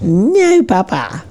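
put-on high, defiant toddler refusing; little girl's firm no. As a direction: play the stubborn little daughter saying no. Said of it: "You get a firm no".

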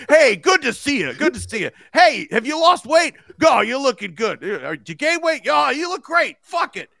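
high, loud back-slapping greeting; jolly dad voice; Midwestern dad. Retold or imitated. A Midwestern dad greets a visitor, high and hearty.

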